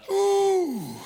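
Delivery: high, drawn-out "ooh"; high whoop. Real, not acted. Preacher letting off steam after exhorting, a high whoop. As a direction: let out a long high "ooh". Worked-up preacher exclaims mid-sermon.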